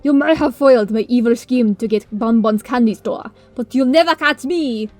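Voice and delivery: loud hammy cartoon baddie; mustache twirling villain.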